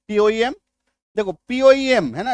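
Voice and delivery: ordinary talk, nothing marked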